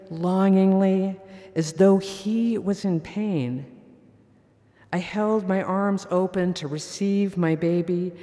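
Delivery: ordinary talk, nothing marked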